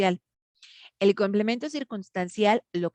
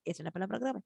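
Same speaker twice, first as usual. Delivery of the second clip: much softer answer to her own question; quiet self-answer; 11 dB quieter, faster than usual. Shown as a demonstration. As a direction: drop to a soft aside. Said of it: "answers own question".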